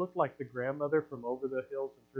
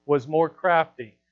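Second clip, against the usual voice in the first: loud, driving sermon delivery; preaching at full force; 10 dB louder than usual. Acted. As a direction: preach it out to the whole church. Preaches loudly to congregation.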